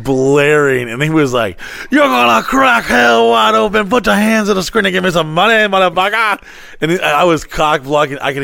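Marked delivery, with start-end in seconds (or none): 1.9-6.4 hammed-up fire-and-brimstone TV preacher; televangelist. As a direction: preach it like a TV evangelist begging money. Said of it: "evangelistic fucking television show".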